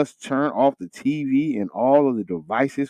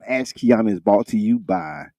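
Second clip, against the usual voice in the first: brisk sponsor read; 3 semitones lower than usual. Acted. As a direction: read it like a radio spot. Reads sponsor announcement.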